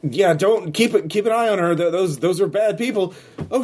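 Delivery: high, rushed, flustered NPC; stammering in alarm; panicky warning. Acted. Frightened witness warns the players about bad people, high panicky voice.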